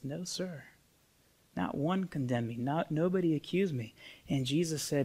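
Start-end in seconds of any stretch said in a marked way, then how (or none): 0.0-3.9 very soft, timid acted answer; hushed, humble retold reply; meek reply. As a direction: play a frightened woman answering quietly. Acting the terrified accused woman replying.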